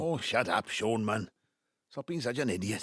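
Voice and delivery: angry retort. Acted. Father lashing out at his son, angry. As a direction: cut him off in anger.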